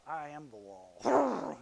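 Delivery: slow, weighty declaration; commanding lion. Acted. Lion silencing the witch, slow and commanding. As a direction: lay it down slowly like a king.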